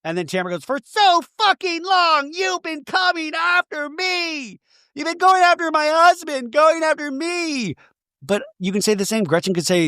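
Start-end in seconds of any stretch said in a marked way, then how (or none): 0.6-7.8 high, slow, hammed-up outrage; mock furious rant. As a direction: whip it up high, drag each line like a reality-TV meltdown. Tamara ranting at Gretchen, retold.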